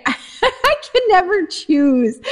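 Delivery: slow, laughing admission. Real, not acted. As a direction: laugh through the words. Asked to pick her favorite.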